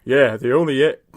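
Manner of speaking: lightly put-on player voice; character voice attempt. Fattest player answers teammates, lightly put-on voice. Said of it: "I can't do this voice".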